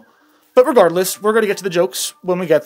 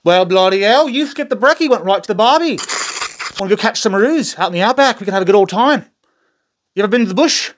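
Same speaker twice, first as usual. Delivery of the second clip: put-on Australian accent; 2 semitones higher than usual. Australian slang comedy bit.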